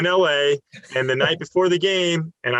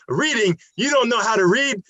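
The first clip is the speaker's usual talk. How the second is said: high-pitched, incredulous challenge; mock pissed off; 8 semitones higher than usual. Angry coach catching out a player who claims he was reading, mock indignant. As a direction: throw it back as a high, mocking question. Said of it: "he's, like, pissed off".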